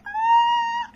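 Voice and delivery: sudden loud, high-pitched scream. Retold or imitated. Viewer retelling an overwhelmed reaction to the film, high scream. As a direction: let out a piercing retold scream. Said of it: "I was like".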